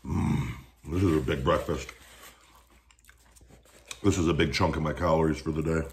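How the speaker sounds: chewing while talking; talking around food; mouth full. Real, not acted. Seen 3: ate a tot just before; jaw and cheek chewing through the words.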